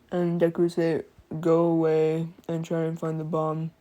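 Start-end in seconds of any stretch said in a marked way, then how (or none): none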